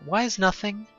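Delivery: ordinary talk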